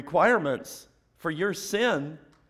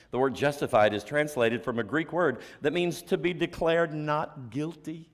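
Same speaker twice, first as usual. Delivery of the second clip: ordinary talk, nothing marked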